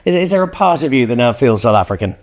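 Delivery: ordinary delivery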